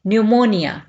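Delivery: न्यूमोनिया said as nyoo-monia with no p sound; न्यूमोनिया with the p silent, like a teacher's model. Shown as a demonstration. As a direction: say न्यूमोनिया without the p, starting on n. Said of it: "यहाँ पे पी जो है ये silent होगा".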